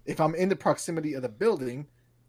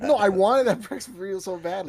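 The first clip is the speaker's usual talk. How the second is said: ordinary talk, nothing marked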